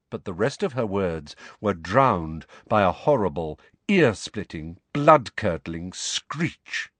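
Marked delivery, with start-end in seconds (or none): none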